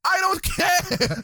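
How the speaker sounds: slow, high, petulant refusal; mock Ollie. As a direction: drag it out stubbornly. Ollie voiced in bickering.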